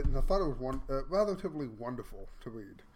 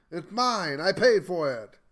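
louder, emphatic claim of ownership; defiant protest; 7 dB louder than usual. Acted. Answering the claim that you'll own nothing, defiant protest. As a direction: push back hard, getting louder.

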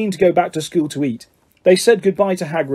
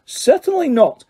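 clipped, much higher and firm; stern refusal; 10 semitones higher than usual. A narrator's reading. McGonagall refuses Harry's plea, sternly. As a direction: shut him down crisply. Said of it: "said Professor McGonagall".

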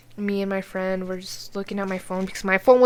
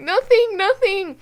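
high, loud panicked nothing; frightened student answering SWAT; scared denial; 9 semitones higher, 7 dB louder, slower than usual. Retold or imitated. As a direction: blurt it out terrified.

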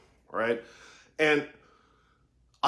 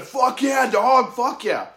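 acted-out enthusiastic congratulations; hype cheer; 7 semitones higher, slower than usual. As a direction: cheer him on like a hyped-up buddy. Imagined cheer from coach.

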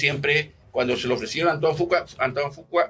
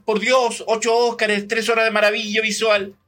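high, emphatic outburst of exasperation; exasperated; 6 semitones higher than usual. Real, not acted. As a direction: insist it's a masterpiece. Exasperated praise of Gandhi.

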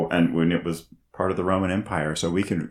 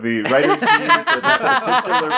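laughing; 8 semitones higher, 5 dB louder than usual. Real, not acted. Joking reaction amid group laughter.

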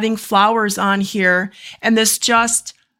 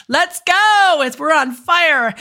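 imitating Debbie; 6 semitones higher, slower than usual. Hearing Debbie's voice, cheering the client on in her voice. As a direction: pump her up like a hyped-up coach. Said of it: "I'm hearing Debbie in my head".

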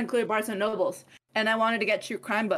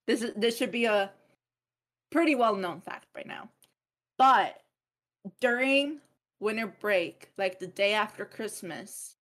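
ordinary delivery

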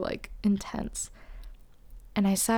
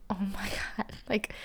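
tearful whisper; 6 dB quieter than usual. Real, not acted. She just cried at table.

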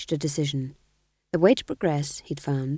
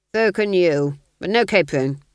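louder, clipped aristocratic command; cold Duke; 6 dB louder than usual. Acted. Duke dismissing the sergeant: louder cold command. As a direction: dismiss him like a bored lord.

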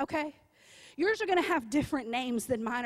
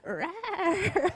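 a high, silly little rawr through a laugh; toy-sized roar, laughing; mock roar; 4 semitones higher than usual. Preacher mocking her bank account with a laughing rawr. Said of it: "And your bank account goes".

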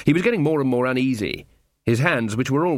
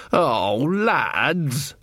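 long, swooping wail of disappointment; drunken dismay; 3 semitones higher than usual. Acted. Jason finding his pals asleep, drawn-out tipsy dismay. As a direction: draw it out, let down by your mates.

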